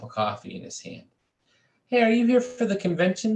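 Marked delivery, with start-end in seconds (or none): 1.9-3.4 high, bright put-on voice for the portly man; chirpy salesman type calling out; portly conventioneer accosting Lewis, high and loud; portly stranger. A narrator's reading.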